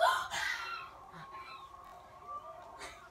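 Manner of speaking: high gasping shriek with laugh; delighted gasp. Real, not acted. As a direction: gasp in thrilled surprise.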